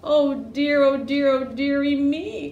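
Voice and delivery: play-acted loud fretting; storybook wail. A narrator's reading. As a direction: wail it theatrically for little listeners. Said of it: "cry cat and dog and pig and duck".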